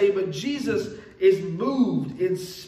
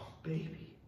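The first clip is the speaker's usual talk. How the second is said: put-on whispered softly to a sick baby; tender whisper; 5 semitones lower, 16 dB quieter than usual. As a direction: breathe it like soothing your crying daughter. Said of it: "and I'm just like".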